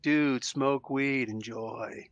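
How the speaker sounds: slow, low, mellow stoner wrestler; Rob Van Dam. Acted. Wrestler giving his laid-back opinion, slow stoner drawl. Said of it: "I can't do a good Rob Van Dam impression".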